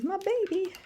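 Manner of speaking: put-on high, sing-song "My baby"; cooing. Shown as a demonstration. Showing viewers how she says it, high coo. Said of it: "So if I say".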